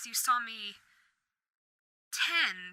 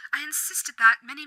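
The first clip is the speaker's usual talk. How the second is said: ordinary talk, nothing marked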